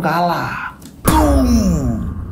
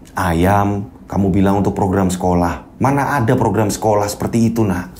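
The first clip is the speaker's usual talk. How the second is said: lower, measured teacher; calm disappointed reproach; teacher questions Hendy about skipping school; teacher's voice; 9 semitones lower than usual. Acted. Teacher scolds gently.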